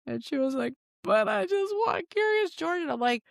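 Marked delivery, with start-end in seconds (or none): 1.0-2.8 put-on high, slow little-kid voice; mock plaintive child plea; pleading child. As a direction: imitate a small daughter begging, pitched way up. Daughter's plea, acted.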